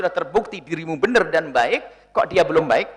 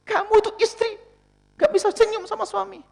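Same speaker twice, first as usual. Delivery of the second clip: scolding husband. Retold or imitated. Husband scolding wife at home.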